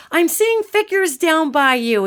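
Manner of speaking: high, drawn-out retold announcement; slow, high-pitched blurt. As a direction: imitate someone blurting it out, pitched well up. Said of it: "somebody just blurted it".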